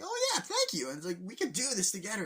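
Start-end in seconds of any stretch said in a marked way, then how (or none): 0.0-0.9 very high, louder squeaky thanks. Retold or imitated. Luigi thanks Mario in retelling. 1.2-2.2 high, upbeat encouragement; cheery brother voice. Retold or imitated.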